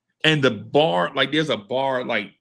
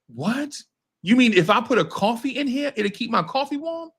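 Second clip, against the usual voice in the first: amazed disbelief; 8 semitones higher than usual. Retold or imitated. Amazed by the heated cup holders.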